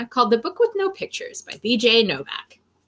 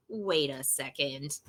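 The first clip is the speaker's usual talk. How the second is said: quiet; 11 semitones lower, 13 dB quieter, slower than usual. Pretend. Suspicious aside.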